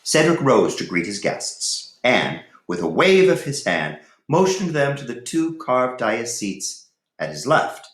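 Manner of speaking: story narration. Acted. Teacher reads Ivanhoe chapter two to students, storytelling.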